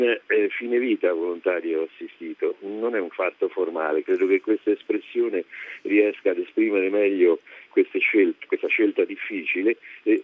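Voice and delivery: ordinary talk, nothing marked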